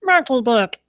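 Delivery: put-on high, playful little-kid voice; childish squeak on a rude word; childlike voice. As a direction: say it like a cheeky small child. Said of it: "in a childlike voice".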